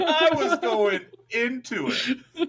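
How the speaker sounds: high, slow, breaking into laughter; laughing. Real, not acted. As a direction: laugh through the words. Laughing while telling.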